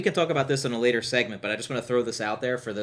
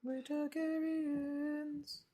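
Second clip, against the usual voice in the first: church voice; 10 dB quieter, much slower than usual. Acted. Reverent church response.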